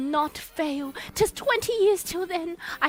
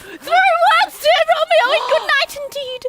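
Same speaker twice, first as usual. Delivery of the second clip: excited calling; 11 semitones higher, 9 dB louder than usual. Acted.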